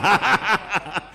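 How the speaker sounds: a loud burst of laughter; amused laugh. Real, not acted. Preacher laughing at his Wahabi mockery, loud burst. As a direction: laugh at the joke you just made.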